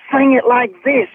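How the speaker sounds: lightly voiced producer giving a vocal demo; imitating a producer. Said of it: "he was like".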